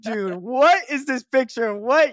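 high, loud what; floored by the picture; stunned disbelief. Real, not acted. Reacting to the photo, high loud disbelief.

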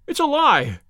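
loud, high accusing yell; frightened patient yelling at the doctor, loud and high. Acted. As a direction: yell it at him in panic. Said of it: "he yelled".

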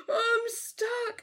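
gasping, high wail, drawn out; Tink panicking. Retold or imitated. Retelling Tink stuck up the church ladder, high hammed-up panic. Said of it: "when he was like".

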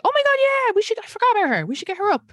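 mock excited booker voice. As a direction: gush like a delighted booker. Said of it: "she was like".

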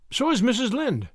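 high, impulsive retort; blurted out. Acted. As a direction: let it slip before thinking. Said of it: "before she could prevent herself".

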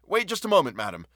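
high, excited call to stop; urgent high-pitched cry; shrill, excited. A narrator's reading. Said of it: "in a shrill, excited voice".

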